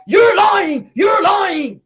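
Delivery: high, loud mock shout; hammed-up outraged holler. Martell storms off accusing Mel, hollering. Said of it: "hollering at Mel".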